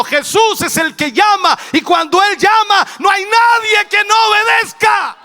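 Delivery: loud sermon building to a shout; fervent, swelling pulpit declaration; full-force preaching. Real, not acted. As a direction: drive it up to a high climax.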